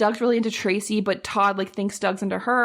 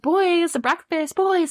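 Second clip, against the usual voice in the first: calling out; 9 semitones higher, slower than usual. Retold or imitated. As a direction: call the boys over to eat. Calling boys to breakfast.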